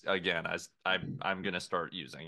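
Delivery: ordinary talk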